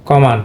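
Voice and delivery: "কমান" with schwa in the second syllable; native pronunciation. Shown as a demonstration. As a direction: stress the first syllable of "কমান". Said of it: "এটা কমান বলতে হবে".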